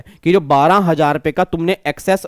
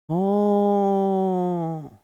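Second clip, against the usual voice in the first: drawn-out realisation; 7 dB quieter, much slower than usual. Retold or imitated.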